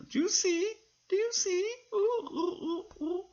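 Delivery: a chirpy little puppet asking questions. Acted. Puppet excited about the comb.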